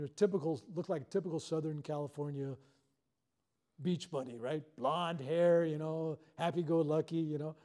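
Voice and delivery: usual speaking voice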